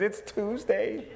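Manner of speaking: slow, light put-on wife voice; a hint of a weary wife; mock wife reply. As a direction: drawl the punchline slowly. Wife's reply in joke.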